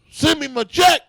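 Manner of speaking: hammed-up demand for the money back; mock demand. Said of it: "That's what I would say if I was him".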